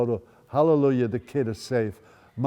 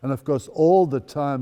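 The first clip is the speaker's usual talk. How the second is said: usual speaking voice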